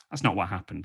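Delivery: normal talking voice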